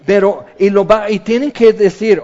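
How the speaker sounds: ordinary talk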